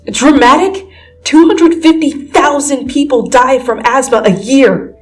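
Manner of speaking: high, loud incredulous comeback; indignant retort. Acted. Peter bristling at being called dramatic, loud and indignant. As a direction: throw the word back at her, outraged.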